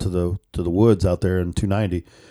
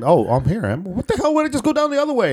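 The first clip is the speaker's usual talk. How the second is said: mock confusion; 12 semitones higher than usual. Driver replaying being lost on the app route, rising baffled pitch. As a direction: pitch climbing into high baffled disbelief.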